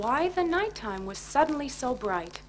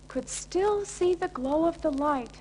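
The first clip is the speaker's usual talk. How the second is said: ordinary delivery, nothing marked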